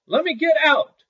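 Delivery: very high, loud pleading yell; panicked shout. A narrator's reading. Sexton trapped in the chest, yelling in panic to Little Claus. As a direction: beg to be let out at the top of your voice. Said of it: "shouted the sexton".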